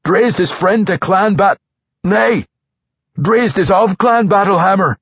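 high, loud defiant dwarf; proud dwarf. Acted. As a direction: proclaim clan loyalty. Dwarf proudly corrects drow.

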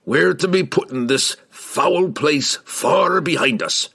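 slow, weighty dwarf voice; gruff dwarf. A narrator's reading. Dwarf urging companions to leave, gruff and grim. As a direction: set the grim course firmly.

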